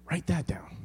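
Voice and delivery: soft whispered aside. Real, not acted. Pastor telling congregation to note the point, whispered. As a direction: whisper it like an insider tip.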